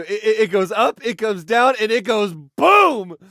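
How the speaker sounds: excited boasting. Acted. Brother showing off what he can do, excited boasting. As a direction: show off, building to a big BOOM.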